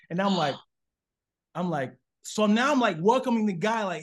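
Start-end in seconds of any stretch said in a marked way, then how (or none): none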